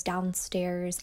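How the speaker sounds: usual speaking voice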